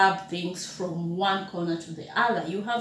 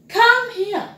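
calling someone; 10 semitones higher, 7 dB louder than usual. Shown as a demonstration. Teacher demonstrating a call.

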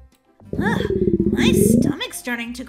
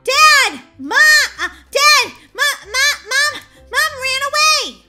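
high, loud, stammering alarm; panicked shouting; 10 semitones higher, 9 dB louder than usual. Acted. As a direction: yell in mounting panic for the family. Child panics on reading the letter.

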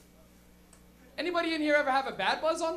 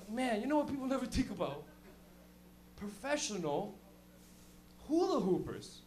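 softer, dead-earnest stoner voice; Bacon, stoned, sharing his big thought in earnest; 2 semitones lower, 6 dB quieter than usual. Retold or imitated. Requoting friend earnestly.

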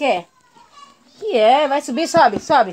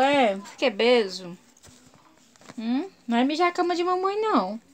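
ordinary talk, nothing marked

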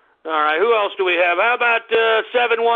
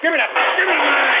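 loud demand; 6 semitones higher, 5 dB louder, faster than usual. Acted. Host demanding mic.

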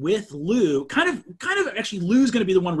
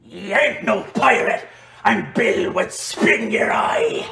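slow, stretched pirate growl; pirate character; 4 dB louder, slower than usual. Acted. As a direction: taunt like a hammy pirate. Game master voices pirate foe.

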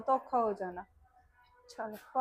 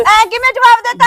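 much higher, much louder outraged shout; furious shout; 11 semitones higher, 20 dB louder than usual. Acted. Girlfriend screaming at boyfriend who broke it off. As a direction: scream it in disbelief.

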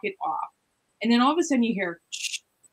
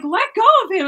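high, louder mock shout at nothing; retold yell; 12 semitones higher, 7 dB louder than usual. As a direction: yell at the invisible thing choking him. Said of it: "what am I yelling at?".

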